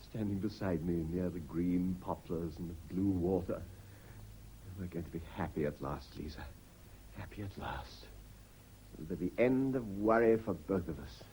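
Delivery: dreamy murmur. Acted. Husband dreams aloud, poison waiting.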